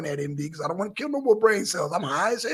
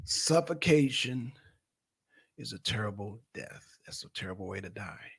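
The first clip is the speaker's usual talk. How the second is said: drained mutter; 12 semitones lower, 11 dB quieter than usual. Real, not acted. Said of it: "I can't hold my breath no more".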